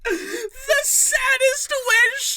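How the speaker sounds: mock lament. Mock-tragic lament while laughing.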